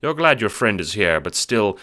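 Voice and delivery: normal talking voice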